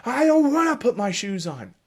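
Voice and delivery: high, loud mock child whine; whining child. Parent playing the child refusing shoes, high and loud. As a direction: ham up a stubborn kid. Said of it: "screaming and saying".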